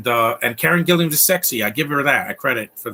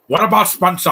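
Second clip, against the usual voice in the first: put-on louder, higher jab at a silly movie accent; mocking the Rock's accent; 4 semitones higher, 6 dB louder than usual. Viewer mocks the Rock's accent in the sequel, loud and high. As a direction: parody the stupid accent in a raised voice. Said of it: "he's doing this stupid accent".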